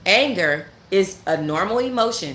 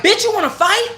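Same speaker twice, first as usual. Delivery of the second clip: much higher, louder mocking challenge; imitated yell; 6 dB louder than usual. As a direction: ham up the girl picking a fight.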